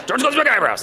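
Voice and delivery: hurried mock telling-off; rapid, mildly stern reprimand; dog scolding. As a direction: scold a dog with nonsense words. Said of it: "but use the same tone".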